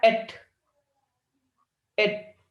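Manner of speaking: "एट" cut off alone as the first syllable of इटर्नल; first syllable isolated. Shown as a demonstration. Teacher counting syllables for students, "एट" pronounced on its own. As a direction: say "एट" as a separate beat, then repeat it. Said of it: "पहला निकला क्या".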